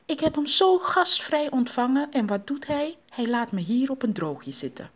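indignant complaint starting high; huffy indignation; fairly angry. A narrator's reading. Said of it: "werd daar vrij kwaad over".

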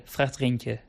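ordinary delivery

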